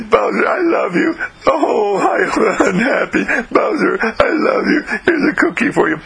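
high, teary "Bowser, I love you"; mock tearful pleading. Girl begs dog, half crying.

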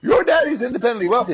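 high, fast incredulous echo; disbelief. Acted. Friend repeating Heinrich's boast, quick and incredulous. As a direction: rattle it back in disbelief.

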